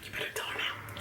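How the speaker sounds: very soft, voiceless whispered prodding; secret whisper. Retold or imitated. Girlfriend prodding boyfriend behind mom's back, secret whisper. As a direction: whisper a secret order to your partner.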